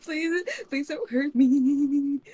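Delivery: high, loud mock-terrified whimper; cowering lizard folk. Cowering cashier begging the armed adventurer, mock terror. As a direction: plead slowly, wailing out the last word. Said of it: "this cowering, uh, lizard folk person".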